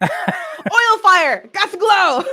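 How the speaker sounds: high laughing shout; laughing loudly. Pretend. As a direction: blurt it out through laughter. Joking catchphrase, laughing.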